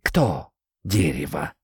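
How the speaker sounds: low, slow, barely heard from a hollow; faint tree voice. Acted. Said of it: "просквозило чуть слышно из дупла". (чуть слышно из дупла)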